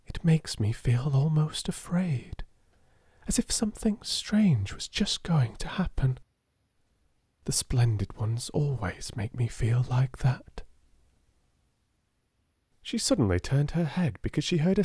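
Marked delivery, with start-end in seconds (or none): 0.0-10.6 awed whisper. A narrator's reading. Sarah whispering her uneasy feeling to herself. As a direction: hush it as if something strange is near. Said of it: "said Sarah softly to herself".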